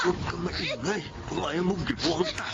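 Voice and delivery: soft threat; menacing hush. Acted. Attacker quietly threatens the struggling girl.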